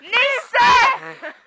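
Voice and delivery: calling out. Real, not acted. Calling out to the cat.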